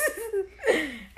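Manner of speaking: bursting laugh, high; laughing at the memory; real laugh. Real, not acted. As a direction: let a real laugh break out. Laughing at her own shock.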